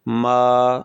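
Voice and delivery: मा held long on an even, steady pitch; level tone demo. Shown as a demonstration. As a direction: sustain मा loudly without bending the note. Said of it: "मैं फिर से इसे एक मरतबा पढ़ रहा हूँ". (मा को लंबा, सपाट सुर में)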